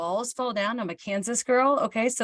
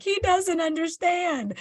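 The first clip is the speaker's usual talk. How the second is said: high, drawn-out whine; exaggerated tearful complaint, pitched way up; mock despairing wail; 9 semitones higher, slower than usual. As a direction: wail it slowly, hammed-up misery. Retold wail at husband's dry answer.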